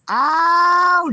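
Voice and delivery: high, loud oh; loud exclamation. Real, not acted. Vlogger reacting loudly to chat. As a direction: burst out with oh.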